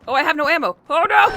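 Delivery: high loud panicked cry; panicking gamer. Real, not acted. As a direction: panic as the ammo runs out. Runs out of ammo.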